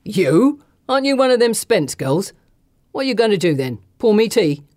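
loud, sneering rough man; mocking thug. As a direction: jeer at the girl. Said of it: "a huge grin and a big cackle".

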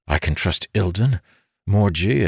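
ordinary delivery, nothing marked

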